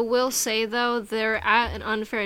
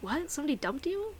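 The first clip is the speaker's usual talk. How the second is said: mock disbelief, softer, rising high at the end; 6 semitones higher, 8 dB quieter than usual. Friends reacting to his being dumped, in mock disbelief. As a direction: imitate incredulous listeners, voice lifting on the question. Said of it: "nobody believed him".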